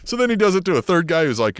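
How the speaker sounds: ordinary delivery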